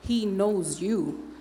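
very slow, each word weighted; slow right down to drive it home; deliberate, measured assurance; emphatic reassurance. Retold or imitated. Reassuring student, slow climax.

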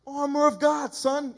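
preacher mocks a hyped-up fighter, shouting; mock battle cry. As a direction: bellow it like a pumped-up warrior.

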